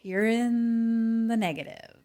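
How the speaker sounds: high, slow, drawn-out announcement; mock sympathy. Host telling the player the score, mock-sorry. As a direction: draw out the bad news, hammed up.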